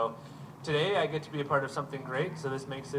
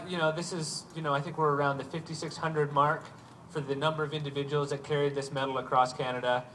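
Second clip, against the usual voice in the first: normal talking voice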